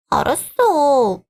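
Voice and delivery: high, long-drawn sulky whine; sulky giving-in. Acted. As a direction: drag it out high, like a pouting little sister. Sister gives in, sulking. (뾰로통하게 늘여 말하기)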